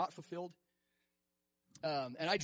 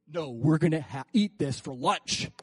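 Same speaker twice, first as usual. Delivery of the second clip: louder bold Caleb boast; bold confidence; 4 semitones lower, 7 dB louder than usual. Retold or imitated. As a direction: declare it like an easy win.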